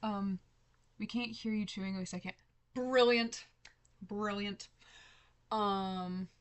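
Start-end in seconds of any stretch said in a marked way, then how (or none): 2.7-3.4 loud, delighted exclamation; excited. Real, not acted. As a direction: burst out with it happily. Reacting to chat comment.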